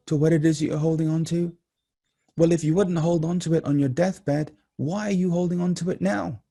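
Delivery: high-pitched, urgent exhortation; impassioned preaching. Real, not acted. Speaker urging listeners to let go as if dying, impassioned preaching. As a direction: plead high and hard with the audience.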